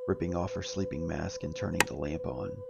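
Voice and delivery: ordinary delivery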